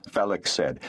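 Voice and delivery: ordinary delivery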